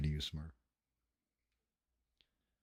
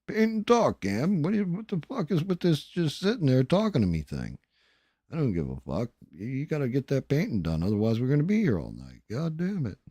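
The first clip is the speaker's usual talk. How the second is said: imitated angry yelling; 8 semitones higher than usual. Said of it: "I didn't have anybody yelling".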